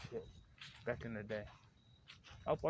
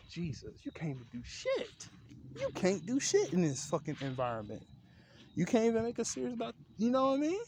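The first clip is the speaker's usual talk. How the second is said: exasperated rant; 9 semitones higher than usual. Real, not acted. Rant building to exasperated peak.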